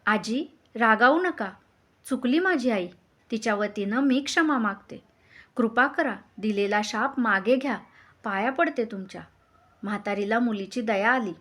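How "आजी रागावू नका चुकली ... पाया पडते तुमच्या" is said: ordinary delivery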